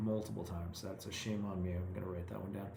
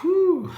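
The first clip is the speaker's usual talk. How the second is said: relieved whew; 15 dB louder than usual. Real, not acted. Finishing a hard sentence.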